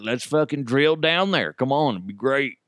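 rally everyone with mock excitement; imagined eager crowd. Crowd urging each other to drill down there, mock eagerness. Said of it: "everybody would be like".

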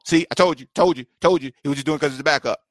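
usual speaking voice

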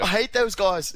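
high, loud jokey Aussie voice; mock Australian accent. Host impersonating Rory to his co-host, hammed-up Australian accent. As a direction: spit it out in a cartoon Australian accent. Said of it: "Every accent is Australian to you".

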